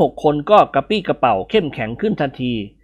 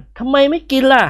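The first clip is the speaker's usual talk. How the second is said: higher teasing question; character voice; 11 semitones higher, 3 dB louder than usual. A narrator's reading. Nikorn teasing his friend, higher character voice. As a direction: tease him in a higher voice.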